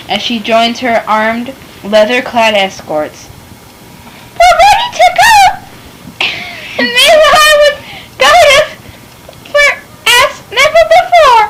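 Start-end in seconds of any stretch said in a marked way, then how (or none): 4.3-11.4 high, joyful rallying cry; excited shout. Acted. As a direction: cheer them on to go. Lita eagerly sets out.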